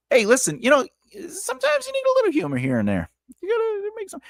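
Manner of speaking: very high, quick comic voice; high-pitched mock pleading; silly character voice. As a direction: squeak it out like a joking cartoon sidekick. Mock voice joking about humor.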